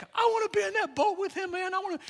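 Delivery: high-pitched, eager fan outburst; excited outburst. Retold or imitated. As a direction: gush it, bursting to join in. Acts out longing to join scene.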